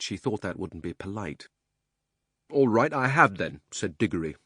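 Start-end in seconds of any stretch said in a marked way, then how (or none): 2.5-3.6 louder, defiant admission; much louder. A narrator's reading. Diggory admitting to Polly he's been crying, loudly defiant. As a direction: blurt it out, not caring who hears. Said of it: "in a much louder voice".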